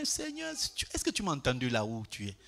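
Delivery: a light, soft imitation of a timid prayer; mock distant prayer. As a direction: pretend to call out to a far-off God. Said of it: "C'est ça nos prières". (imitation légère d'une prière timide)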